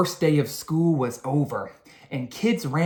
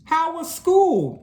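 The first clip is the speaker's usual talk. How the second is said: high, slow motherly question; Mother asking; 13 semitones higher, 4 dB louder than usual. A narrator's reading. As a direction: ask like a kind mother. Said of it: "Mother asked".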